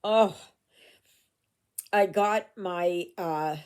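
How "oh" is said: ordinary delivery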